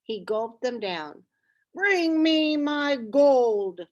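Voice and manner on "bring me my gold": higher, louder, slow giant roar. A narrator's reading. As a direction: demand it like a greedy giant. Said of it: "the giant roared".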